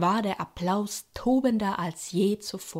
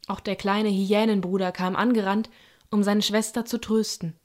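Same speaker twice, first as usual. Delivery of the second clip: usual speaking voice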